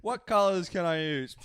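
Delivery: loud, slow, drawn-out question; hammed-up pondering. Friend recalling his painting choices, loud mock pondering. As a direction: blare out the question word by word.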